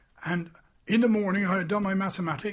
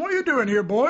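stern challenge; 5 semitones higher, 6 dB louder than usual. Retold or imitated. Sergeant major catches boy.

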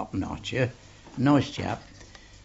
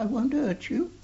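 cocky offer; 13 semitones higher than usual. Retold or imitated. Cherry repeating his cocky promise.

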